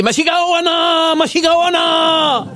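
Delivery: cri aigu et lent; crie-le comme au lit, très aigu; cri de la Japonaise. Acted. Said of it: "la japonaise ne cesse de crier". (la Japonaise crie pendant les ébats)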